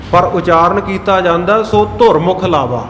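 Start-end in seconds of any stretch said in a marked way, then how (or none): 1.6-2.9 ਧੁਰ with a short vowel, like "dhor"; mispronounced ਧੁਰ. Shown as a demonstration. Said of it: "ਧੁਰ ਸ਼ਬਦ ਦਾ ਤਾਂ ਅਰਥ ਹੀ ਨਹੀਂ ਬਣਦਾ".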